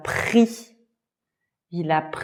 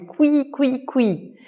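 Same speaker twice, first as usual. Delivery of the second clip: mouse squeak; 6 semitones higher than usual. A narrator's reading. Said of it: "Il a entendu".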